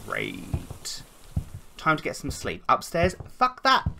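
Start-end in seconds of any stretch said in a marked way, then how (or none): 3.4-3.9 sudden high yelp of refusal; real spooked outburst; startled refusal. Real, not acted. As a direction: blurt it out, spooked. Refusing to go upstairs in horror game.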